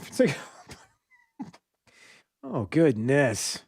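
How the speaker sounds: breathy laughter through the words; real laugh, words barely voiced. Real, not acted. Laughing at the clip.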